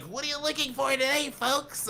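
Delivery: high-pitched character greeting to customers; put-on persona. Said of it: "stay in that persona".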